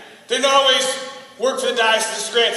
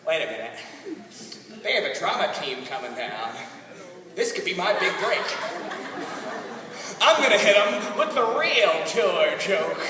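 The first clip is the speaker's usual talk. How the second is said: put-on old Southern man hatching a joke; [name], plotting; 7 semitones lower, 5 dB quieter than usual. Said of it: "like he was sitting on his couch".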